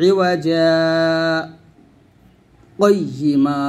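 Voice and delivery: ইওয়াজা, then a short silent break before কয়িমা without taking breath; saktah pause. Shown as a demonstration. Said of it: "ইওয়াজা বলে একটু থেমে গেছি". (ইওয়াজা বলে শ্বাস না ফেলে একটু থামা)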